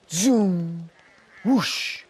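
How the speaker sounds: high, loud whooshing sound; rushing whoosh. A narrator's reading. A crowd rushing past the wolf, whooshing. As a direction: swoosh it past fast.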